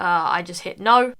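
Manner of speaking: ordinary talk, nothing marked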